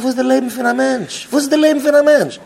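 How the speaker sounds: high, loud rhetorical cry; impassioned outcry. Acted. Speaker challenges someone about life, impassioned outcry.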